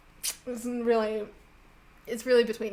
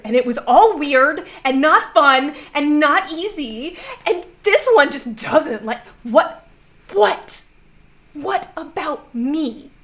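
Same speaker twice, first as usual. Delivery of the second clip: loud, high-pitched indignant rant; very upset; 5 semitones higher, 9 dB louder than usual. Acted. Upset player complaining to the clipboard lady, indignant outburst. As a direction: protest louder and higher, drawing out the last words. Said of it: "who is in fact looking very upset".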